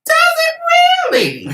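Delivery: very high, loud, drawn-out mock surprise; mock amazement. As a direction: shriek it slowly in exaggerated sarcastic disbelief. Mock surprise at friend's late realisation.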